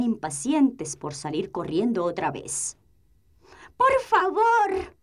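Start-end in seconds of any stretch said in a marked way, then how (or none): 3.8-4.9 "¡Por favor!" very high, loud and slow; shouted plea. Acted. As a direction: yell "¡Por favor!" stretching each word. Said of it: "gritó".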